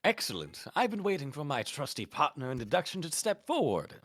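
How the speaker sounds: high, loud grand detective voice; theatrical British detective. Acted. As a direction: announce it like a proud sleuth. Second character voiced, louder, higher.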